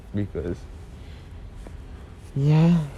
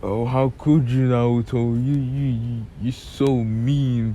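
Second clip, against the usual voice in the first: whiny; 3 semitones higher than usual. Retold or imitated. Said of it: "Sakura ... is like".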